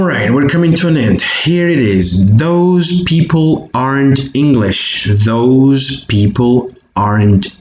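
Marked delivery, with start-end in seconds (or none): 2.4-5.1 model reading. Shown as a demonstration. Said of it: "is pronounced those".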